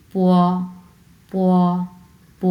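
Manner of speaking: প gliding into a long o, "pwo"; p joined with o. Shown as a demonstration. Teacher showing learners p joined with o, gliding sound. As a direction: join প smoothly to a held o. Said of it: "এই প এর সঙ্গে ও যদি আমি যুক্ত করি".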